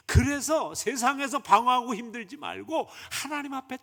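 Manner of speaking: rally the flock; preaching. Acted. Pastor urging believers to come to God, preaching.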